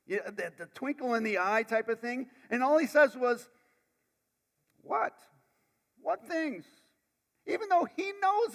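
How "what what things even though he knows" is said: mischievous. Retold or imitated. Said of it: "a mischievous kind of humor".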